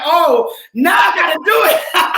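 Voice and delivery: high, slow, put-on shocked voice; a playful fake alarm at too many signups; mock panic. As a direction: squeal it like the joke's on you. Retelling shocked reaction to signups.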